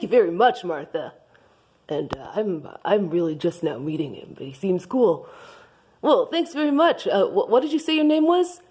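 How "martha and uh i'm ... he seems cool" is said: low, quiet voice; lowest voice possible. Acted. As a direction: sink the voice down low. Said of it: "Lowest Voice Possible Johnson".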